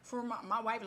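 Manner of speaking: acted tearful begging without real sobs; retold pleading. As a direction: plead on someone's behalf, imitating desperation. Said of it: "he starts pleading with God ... he's crying".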